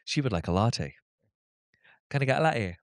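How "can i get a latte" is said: put-on strong Geordie accent with swallowed T's. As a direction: run the words together in thick Geordie. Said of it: "Love that Geordie accent".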